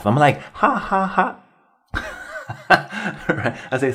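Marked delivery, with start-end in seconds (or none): 0.5-1.4 high, spoken-out ha ha ha; mock laugh said as words. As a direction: fake a breezy laugh at an insult. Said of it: "I just had a laugh".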